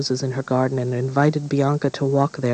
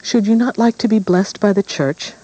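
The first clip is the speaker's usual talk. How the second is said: coaxing queen; 8 semitones higher, 4 dB louder than usual. Acted. Witch queen coaxing Bianca.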